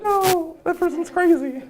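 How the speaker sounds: put-on high kid voice backing away; frightened kid. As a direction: shrink away like a spooked kid. Kid refusing the candy.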